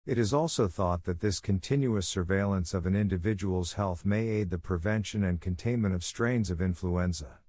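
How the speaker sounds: ordinary delivery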